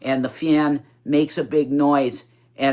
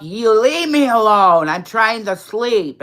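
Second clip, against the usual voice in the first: retold scream; 6 semitones higher, 5 dB louder than usual. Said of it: "he just screamed up".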